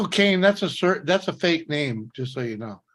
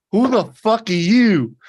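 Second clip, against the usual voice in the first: higher, louder tough-guy challenge; 4 semitones higher, 4 dB louder than usual. Retold or imitated. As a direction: bark it to scare him off. Manning up against biker prospect.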